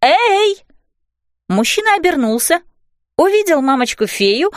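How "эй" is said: high, short hail; calling out. A narrator's reading. Fairy mum to man on next roof, calling out. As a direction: hail them high to get attention. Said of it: "окликнула она его".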